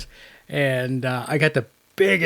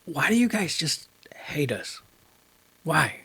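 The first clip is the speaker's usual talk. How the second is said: hushed unvoiced whisper; 2 semitones higher, 6 dB quieter than usual. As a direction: whisper the question. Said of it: "I asked him, I said".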